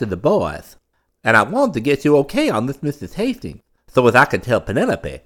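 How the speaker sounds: high, loud, eager wheedling voice; butter up the old man, eager and nervous; fawning nephew. A narrator's reading. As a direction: nephew wheedling his uncle for approval.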